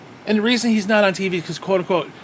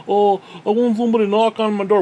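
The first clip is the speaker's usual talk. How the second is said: mocking quote, pitched up; mocking imitation; 4 semitones higher, 4 dB louder than usual. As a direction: mimic the line with open scorn. Mimics wrestler's scripted promo line.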